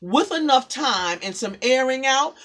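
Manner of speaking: loud, rousing spoken word; poem recital. Acted. Poet drives her poem to a loud rousing close. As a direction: declaim it with growing fire.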